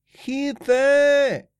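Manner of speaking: high, loud cry for help; shout for help. A narrator's reading. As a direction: yell out for help. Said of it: "rief er".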